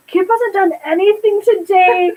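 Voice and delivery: put-on indignant protest; complaining Napoleon. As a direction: lightly imitate a sibling tattling. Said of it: "And he's like".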